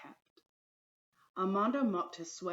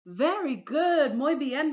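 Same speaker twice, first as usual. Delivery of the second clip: warm praise; 8 semitones higher, 8 dB louder than usual. Acted. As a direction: praise them gladly. Teacher praises pupils.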